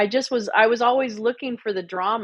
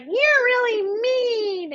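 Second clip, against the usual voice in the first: much higher, slow, fake-crying whine; mock her as a wounded crybaby; hammed-up tearful high whimper; mock whiny crybaby; much slower than usual. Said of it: "And she was like".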